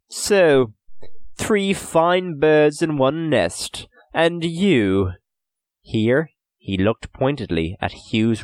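high, slow smug English merchant. A narrator's reading. Merchant Birmingham gloating over his prisoners, smug. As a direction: gloat over the captured boys.

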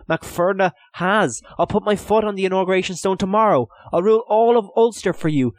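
high, urgent begging; desperate pleading. A narrator's reading. Prisoner begs for it all to be undone, desperate pleading. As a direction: bargain desperately, pitched right up. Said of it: "he wanted to plead".

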